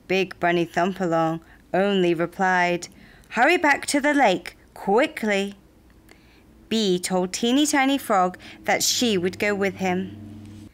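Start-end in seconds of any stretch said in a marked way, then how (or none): 3.3-5.6 quick, high-pitched urging; urgent. A narrator's reading. Bunny sends frog back to the lake, urgent and quick. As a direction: rush the words out.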